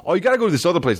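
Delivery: high mocking bro voice; pushy local. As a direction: mimic the nagging advice. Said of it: "and then somebody".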